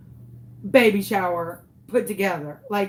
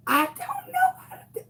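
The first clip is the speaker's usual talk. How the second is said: squeaky breaking wail; 4 dB quieter than usual. Retold or imitated. Panicking to her girlfriend.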